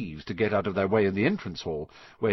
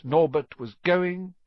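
slow and drawn out; 7 semitones higher, 3 dB louder, slower than usual. A narrator's reading. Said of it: "going, going, gone".

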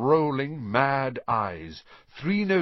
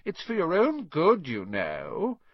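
sanctimonious; 7 semitones higher than usual. A narrator's reading. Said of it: "in a saintly voice".

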